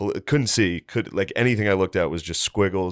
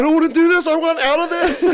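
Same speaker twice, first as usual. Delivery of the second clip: high, loud mock freak-out; mock panicked yell; 6 dB louder than usual. Imitating the friend who came down wanting out, mock panic. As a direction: yell it in a hammed-up panic, much higher.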